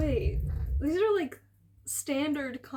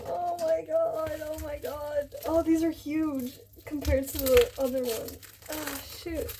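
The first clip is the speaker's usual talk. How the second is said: shrieking jackpot winner; 4 semitones higher than usual. Real, not acted. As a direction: gasp it out, overwhelmed with joy. Unboxing, overwhelmed by the books.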